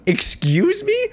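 high, slow stunned "excuse me"; shocked disbelief. Retold or imitated. Insulted champion reacting to the press, stunned and high. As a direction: pitch it way up in disbelief. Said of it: "And he just like".

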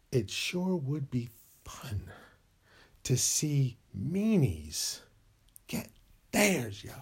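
slow conspiratorial whisper. Acted. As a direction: hush it like a secret scheme. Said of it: "whispered scheming".

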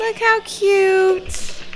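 slow, high cooing "look how cute"; drawn-out doting coo. Real, not acted. Cooing at couple on screen.

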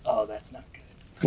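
quiet, drawn-out creature aside; Igni. Acted. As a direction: understate the worry, low and slow. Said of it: "And Igni goes".

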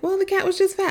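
put-on high, light little-girl voice for her daughter; child's answer. Mom imitates daughter saying the cat was fat, high child voice. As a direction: pitch it up like a small girl. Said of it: "And she was like".

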